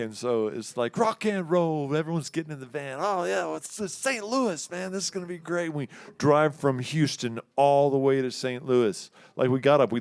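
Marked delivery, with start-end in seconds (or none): 3.0-5.7 mock hype. Bandmates hyped in the van.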